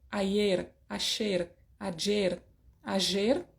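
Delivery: ayer with y, sh and zh sounds in turn; regional variants of ayer. Shown as a demonstration. Teacher shows Spanish accent variants of ayer. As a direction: ayer said four ways, aller, asher, azher. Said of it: "cuando yo pueda decir".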